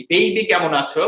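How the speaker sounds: ordinary talk, nothing marked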